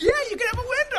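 high, loud, bright airline agent; cheery agent voice. Retold or imitated. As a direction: play the gate agent granting a wish. Quoting the check-in lady.

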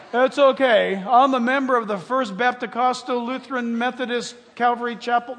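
loud, rattling off church names; loud mock excuse. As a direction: blurt it out proudly, loud.

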